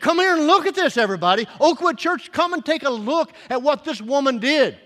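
high, loud summons to a congregation; crying out. Retold or imitated. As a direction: call everyone over at full preaching force. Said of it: "he's crying to you and me".

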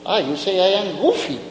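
ordinary talk, nothing marked

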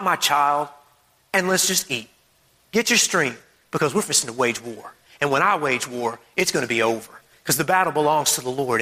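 low, unhurried reassurance; God. Acted. God to his child before waging war, relaxed. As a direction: speak low, calmly in control. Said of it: "He takes it easy".

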